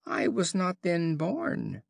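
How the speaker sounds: high, slow, plaintive lamb voice; a sad little lamb pleading its innocence; the lamb pleads mournfully with the wolf. A narrator's reading. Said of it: "bleated the lamb in a mournful tone of voice".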